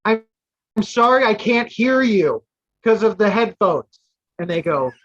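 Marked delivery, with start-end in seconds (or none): 0.0-4.0 louder and higher, talking over headphones; raised voice of someone wearing headphones. Retold or imitated. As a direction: call out as if you can't hear yourself. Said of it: "took one headphone off and I said".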